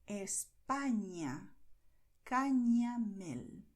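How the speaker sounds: slow "España" and "Cañamel" with a "ny" sound for ñ; Spanish Ñ. Shown as a demonstration. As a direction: draw out "España", "Cañamel", modelling the ny. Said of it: "is pronounced as you hear here".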